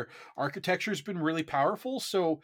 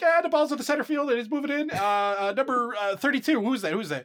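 lightly voiced sports commentator; sportscaster play-by-play; 9 semitones higher, 6 dB louder than usual. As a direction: call the match like a sportscaster. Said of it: "I was doing the play-by-play for you".

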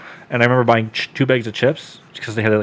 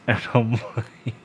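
usual speaking voice

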